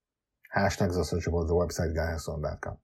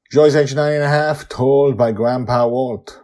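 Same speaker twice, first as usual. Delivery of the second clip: high, loud proclamation; story title announcement; 6 semitones higher, 12 dB louder than usual. Acted. Narrator introduces Grandpa Walt's tale to listeners, loud announcing voice. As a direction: proclaim it like an opening credit.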